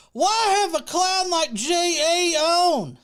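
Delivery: high, loud, slow put-on complaint; hammed-up scornful question, words drawn out; mock-outraged comment reading. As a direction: read it like an indignant heckler. Reading a viewer's hostile comment aloud.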